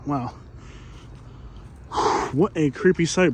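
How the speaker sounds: ordinary talk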